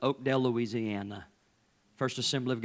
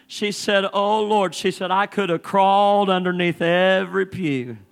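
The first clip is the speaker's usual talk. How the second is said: high, loud, driving preacher cadence; preaching at full force; 10 semitones higher, 8 dB louder than usual. Acted. Pastor retelling his mother-in-law's story to the congregation, full-force preaching.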